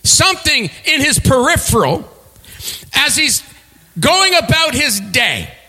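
rising preacher's voice, building the scene; preaching a Bible story with swelling pitch; sermon storytelling. Acted. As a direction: paint the desert for the congregation.

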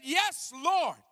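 high ringing shout of surrender; shouted cry. Acted. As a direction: cry it out to the Lord. Voicing a believer's cry.

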